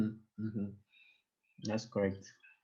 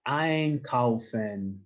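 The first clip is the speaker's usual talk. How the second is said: Einkaufen, drawn out with a loud EIN; stress on first syllable; 3 dB louder, much slower than usual. Shown as a demonstration. Teacher showing a class where the emphasis falls, first-syllable stress on Einkaufen. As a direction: lean hard and slow on the Ein of Einkaufen. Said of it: "We have found the emphasis".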